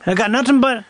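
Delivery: hammed-up Cajun accent. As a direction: ramble like a Louisiana Cajun. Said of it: "the Cajuns like this".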